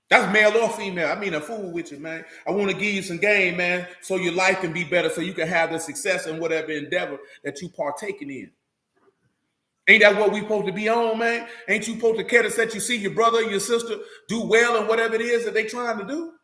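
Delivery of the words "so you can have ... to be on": ordinary talk, nothing marked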